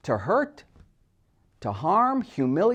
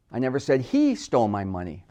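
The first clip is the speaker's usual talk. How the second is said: single out "he", the rest falls away; stress on HE; 4 semitones lower than usual. Shown as a demonstration. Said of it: "simply stressing different words".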